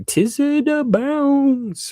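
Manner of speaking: high, drawn-out name; playful shout-out. Real, not acted. Host thanking a gifting viewer, playful shout-out.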